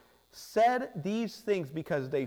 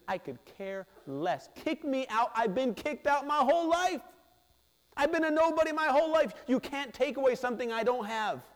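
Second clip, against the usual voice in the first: mounting, higher defiant cry, faster near the end; defiant outburst; 6 semitones higher than usual. Acted. Healed man defying the Pharisees, building defiant cry.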